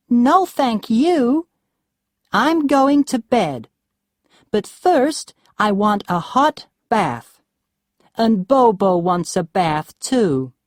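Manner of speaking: high, loud, whiny rich-wife voice; spoiled Roxanne, sulky. A narrator's reading. Roxanne refuses cold food from Duncan, high whiny complaint. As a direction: pitch her up high and let her complain.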